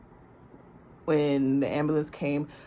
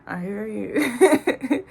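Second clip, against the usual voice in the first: tipsy; 4 semitones higher, much slower than usual. Retold or imitated. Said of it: "I'm a little faded".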